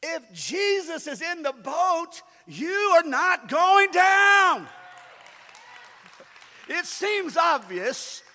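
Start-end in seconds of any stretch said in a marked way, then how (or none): 0.0-4.7 slow, high, building shout; emphatic preaching. Real, not acted. Preacher assuring congregation about the boat, slow emphatic shout. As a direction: hammer out each word to a loud climax.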